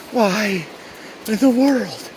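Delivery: high pitched exasperated cry. Real, not acted. Exasperated at more climbing.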